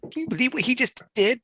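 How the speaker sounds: high-pitched mock gushing; excited gossip. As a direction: squeal it like a giddy friend on the phone. Excited phone gossip between friends, acted.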